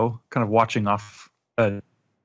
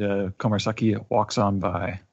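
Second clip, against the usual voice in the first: ordinary talk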